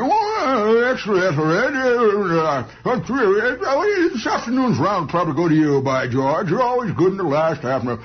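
hearty, blustering noble congratulating his rival; lively mock-aristocrat chatter; lightly voiced nobleman. Noble complimenting his opponent over drinks, hammed-up and hearty.

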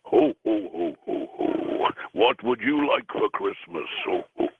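jolly Santa ho-ho-ho; Santa Claus. Acted. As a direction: play Santa greeting a child, booming ho-hos around the question. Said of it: "do your best Santa Claus ho, ho, ho impression".

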